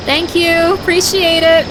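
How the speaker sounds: high, loud mock gratitude; loud sarcastic thanks.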